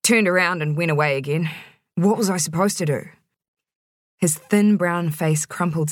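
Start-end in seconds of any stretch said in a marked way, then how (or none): none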